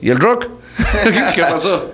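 teasing question breaking into a laugh. Real, not acted. Musician teasing the host about rock, laughing. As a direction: tease him and laugh through it.

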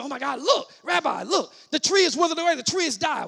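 high, loud excited outcry; all excited. Acted. Peter shows Jesus the withered tree, all excited. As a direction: act an amazed disciple calling to his rabbi. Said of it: "he's all excited about it".

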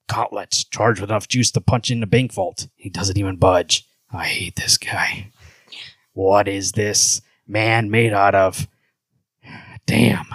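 low, slow brooding narration; Batman. Acted. Host reading Batman's thoughts, low slow voice. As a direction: sink it low and deliberate, frustrated. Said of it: "low voice for Batman".

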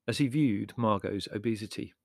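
plain reading voice

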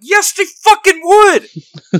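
slow, high furious shout; going off on someone. Retold or imitated. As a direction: yell the rebuttal, dragging out every word. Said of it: "I went off on him so bad".

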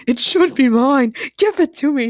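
loud, high greedy demand; shout. A narrator's reading. Sean lunges for the SD card, shouting. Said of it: "Sean shouted".

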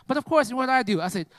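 ordinary talk, nothing marked

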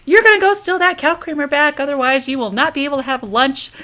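bossy aunt. Retold or imitated. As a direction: lay down the law as an imperious aunt. Voicing the aunt's threat to nephew.

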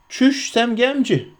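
slow, high-pitched self-reproach; aloud self-scolding. A narrator's reading. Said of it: "dedi yüksek sesle". (tiz sesle, uzatarak kendini azarlama)

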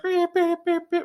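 high, piercing beep beep like an alarm; siren imitation. Host mimicking the rental's alarm siren, high beeping. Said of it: "it's literally a big siren".

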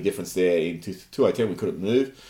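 ordinary talk, nothing marked

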